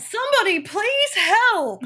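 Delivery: high, strained cry for help, slow; mock damsel wail; mock scream. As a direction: ham up a panicked plea, high and loud. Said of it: "She's like, screams".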